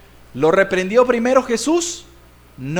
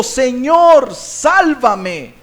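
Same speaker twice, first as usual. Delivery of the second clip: loud, drawn-out plea to the Lord; crying out; 4 semitones higher, 5 dB louder, much slower than usual. Acted. Peter asking Jesus, not the disciples, crying out. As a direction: call out for rescue. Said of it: "comenzar a gritar".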